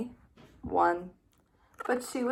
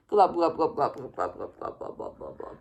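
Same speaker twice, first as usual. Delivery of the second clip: sinking away; 7 semitones lower than usual. A narrator's reading. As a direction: let the glubs sink into the mud. Fish sinking into the deep.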